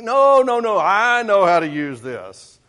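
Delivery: put-on high, loud, insistent boy refusing; loud, eager child brushing off help; kid's loud protest. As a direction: shout it like an impatient kid who knows best.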